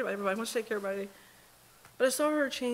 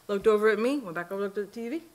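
ordinary talk, nothing marked

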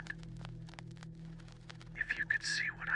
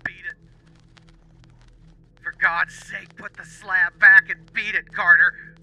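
much higher, louder desperate cry; piteous cry; 9 dB louder than usual. Acted. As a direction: scream the warning in anguish. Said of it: "a piteous cry from Warren".

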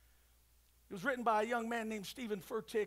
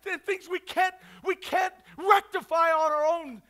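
raised, urgent sermon voice; high, loud, insistent exhortation; full-force preaching; 6 semitones higher, 12 dB louder than usual. Real, not acted. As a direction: preach hard to a packed room.

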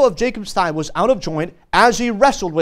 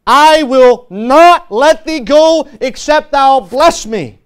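loud, slow and emphatic refusal to let go; cling on with a drawn-out, forceful declaration; insistent beggar; 3 semitones higher, 5 dB louder, much slower than usual. Acted. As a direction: plead with force, weighting each word. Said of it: "Here it is, the beggar in spirit".

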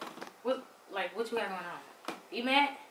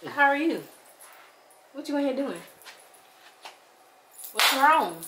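normal talking voice

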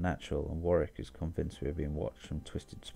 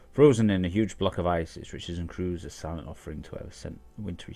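louder storyteller reading; story narration; 5 dB louder than usual. Acted. Player reading a lore book to viewers as narration. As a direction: tell the legend like a bard.

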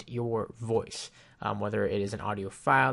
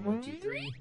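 much higher, swirling flanged "one, two, three"; flanger effect; 5 dB quieter, slower than usual. Shown as a demonstration. Reviewer plays the test clip back with flanger, swirling effect. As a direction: play it back through the crazy flanger. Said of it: "this crazy flanger effect".

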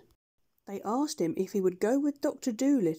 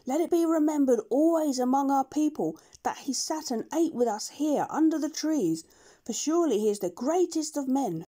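solemn monkeys; 6 semitones higher than usual. Acted. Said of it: "said to one another".

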